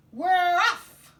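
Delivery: berro agudo e muito alto de partida; todos gritando. Acted. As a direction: grite como uma tripulação zarpando. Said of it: "we all yelled".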